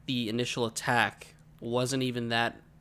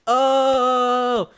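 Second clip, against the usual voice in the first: long, loud, high "ohhh"; badly faked cry of falling down the stairs; drawn-out fake fall yell; 10 semitones higher, 8 dB louder than usual. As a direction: hold one loud raised note. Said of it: "I just kept going".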